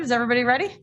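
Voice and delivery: normal talking voice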